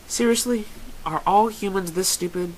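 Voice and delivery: high-pitched mocking disbelief; exasperated, needling jab; taunting. A narrator's reading. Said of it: "Bon Bon taunts".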